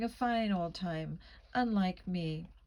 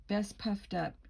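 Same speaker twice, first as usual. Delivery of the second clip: ordinary delivery, nothing marked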